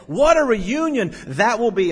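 high, loud imitated delight; retold joyful exclamation. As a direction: exclaim it like his overjoyed friend. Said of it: "always had this joyful tone".

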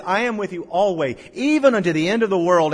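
booming scripture promise; preached quote. Acted. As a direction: declare it triumphantly to the hall. Quote now preached loudly.

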